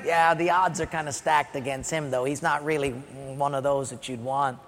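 low, subdued doubtful objection; reluctant father. Acted. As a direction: quietly talk down your own kid. Jesse doubts his son.

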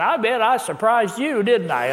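playful mimicked southern lilt; southern accent. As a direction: tease it out in a high southern accent. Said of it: "in a southern accent".

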